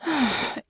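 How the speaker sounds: long breathy exhale of frustration; frustrated sigh. A narrator's reading. Russo hanging her head, frustrated sigh. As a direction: sigh it out, exasperated. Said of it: "let out a loud sigh of frustration".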